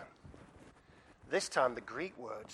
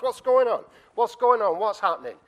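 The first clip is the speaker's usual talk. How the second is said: loud, high, urgent questions; acted blind man demanding to know; insistent asking; 9 semitones higher, 15 dB louder than usual. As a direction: call out to anyone who'll answer. Blind man asks the crowd louder.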